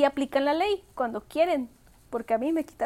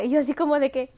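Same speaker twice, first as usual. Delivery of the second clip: normal talking voice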